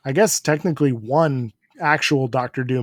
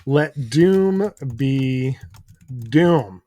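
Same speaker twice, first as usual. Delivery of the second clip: drawn-out echo; much slower than usual. Pretend. Echoing the Doom chant.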